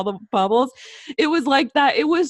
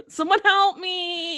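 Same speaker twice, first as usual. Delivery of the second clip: slow, higher whimper; mock helpless plea; 6 semitones higher, slower than usual. Friend's helpless stoned plea, slow whimper. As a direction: drag it out helplessly. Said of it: "I don't know".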